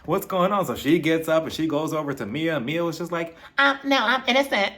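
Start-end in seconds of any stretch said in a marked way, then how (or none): 3.6-4.7 high, giggly scared little voice; mock scared. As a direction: squeak it out like she's caught. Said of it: "She was scared".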